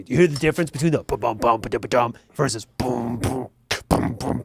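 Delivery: low, rhythmic vocal drum imitation, ba-dum and boom; beatbox the breakdown in a low voice; mouthed drum beats. Explaining breakdowns to a friend, low mouthed drum beats.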